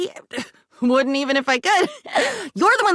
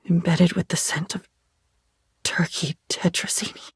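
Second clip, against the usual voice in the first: whisper; 4 semitones lower, 3 dB quieter than usual. Acted. Trailing memory of lunchroom.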